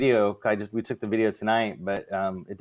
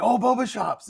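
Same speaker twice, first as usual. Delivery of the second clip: a lightly voiced kid's delight, pitched up high; excited kid; 12 semitones higher than usual. Daughter Amelia lighting up at the idea of boba shops, excited. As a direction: perk up like a kid hearing a treat. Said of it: "And Amelia's like".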